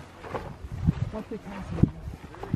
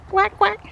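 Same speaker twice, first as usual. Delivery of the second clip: high duck quacks; quack imitation; 14 semitones higher than usual. Calling to the ducks.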